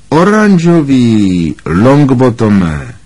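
high, slow, drawn-out jeer; mock the pupil, stretching every word; sneering mockery. A narrator's reading. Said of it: "posmíval se Snape". (posměšně, protáhle a vysoko)

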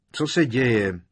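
high, loud startled question; blurted out. A narrator's reading. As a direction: blurt it out in alarm. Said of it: "vyhrkl Fred".

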